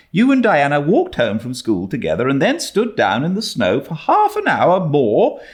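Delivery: a hint of a scolding guardian; mildly put-on older woman, chiding; lightly voiced stern Marilla. A narrator's reading.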